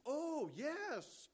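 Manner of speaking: imitating her sudden high, loud delight; light up with a loud, very high yes; delighted outburst. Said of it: "her eyes popped and she goes".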